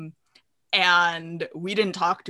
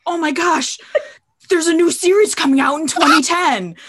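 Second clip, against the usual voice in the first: high, loud imitation of giddy excitement; really excited; 9 semitones higher, 7 dB louder than usual. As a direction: blurt it out like a thrilled fan, hammed up. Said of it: "gets really excited and is like".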